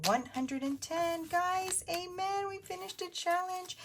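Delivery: high, delighted cheer; excited. Real, not acted. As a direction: celebrate finishing the challenge. Cheering a finished challenge.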